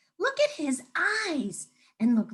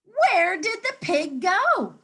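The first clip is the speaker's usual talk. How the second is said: higher, slow and louder worried call; alarmed animals; 9 semitones higher, 5 dB louder, slower than usual. Acted. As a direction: look around in alarm.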